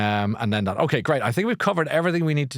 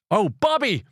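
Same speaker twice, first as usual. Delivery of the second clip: calling out cheerily; 12 semitones higher than usual. Real, not acted. Calling out to birthday boy.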